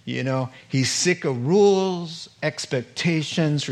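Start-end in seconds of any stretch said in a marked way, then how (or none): none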